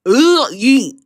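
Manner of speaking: short grunt then yeet; mock chant. Vlogger tries a shorter version of the chant. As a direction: clip it to one grunt and yeet. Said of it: "or just".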